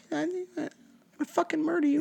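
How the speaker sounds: high, quiet tiny furious fairy voice; tiny angry fairy. Pretend. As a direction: squeak a tiny death threat. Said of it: "'cause you'd be like".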